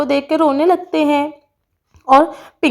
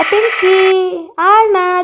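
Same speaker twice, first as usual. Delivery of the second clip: high, drawn-out call to a friend; 5 semitones higher, much slower than usual. Acted. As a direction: sing out the name in delight. Greeting a friend by calling out.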